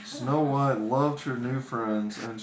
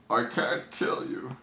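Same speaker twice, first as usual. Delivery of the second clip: sobbing. Acted. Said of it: "he sobbed".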